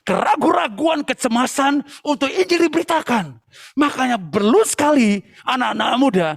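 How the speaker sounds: insistent sermon appeal, pitch held up; preaching. Real, not acted.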